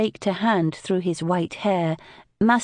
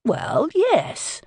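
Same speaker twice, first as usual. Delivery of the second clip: defensive; 11 semitones higher than usual. A narrator's reading. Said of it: "Papa said defensively".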